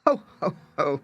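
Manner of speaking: menacing cat laugh in a changed voice; a hint of a dreadful booming laugh; terrible voice. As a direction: gloat like a monstrous cat. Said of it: "cried the cat in a terrible voice".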